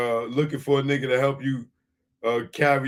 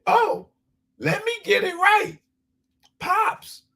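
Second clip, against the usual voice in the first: very high, squeaky mock woman's voice; mock lady voice. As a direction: mimic a flustered interviewer in falsetto. Mocking the interviewer lady again.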